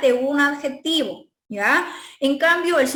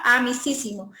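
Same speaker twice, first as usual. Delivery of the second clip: normal talking voice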